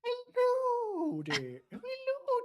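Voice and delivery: put-on very high, slow elderly singsong greeting; Mrs Doubtfire. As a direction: sing it out like a sweet old nanny. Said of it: "it's Missus Doubtfire".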